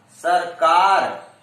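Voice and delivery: सरकार slowed, का drawn out and pushed; slow demo of सरकार stressed on का. Shown as a demonstration. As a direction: stretch and hit the का of सरकार. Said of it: "का पर बल का प्रयोग".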